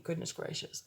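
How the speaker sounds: breathy whispered goodness gracious; whispered aside. Real, not acted. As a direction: mutter it under your breath. Muttered aside while applying makeup.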